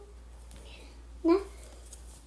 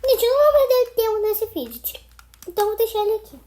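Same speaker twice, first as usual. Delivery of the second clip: louder, higher, giving up; exasperated; 6 semitones higher, 7 dB louder than usual. Real, not acted. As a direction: toss it aside, fed up. Gives up on cube.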